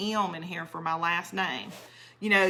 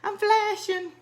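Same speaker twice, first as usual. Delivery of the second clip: hot flash squeal; 13 semitones higher, 4 dB louder than usual. Real, not acted. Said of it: "but I'm hot".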